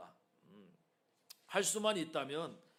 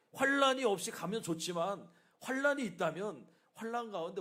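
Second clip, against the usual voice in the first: rising preaching cry; 6 semitones higher, 7 dB louder than usual. Real, not acted. Preaching crescendo.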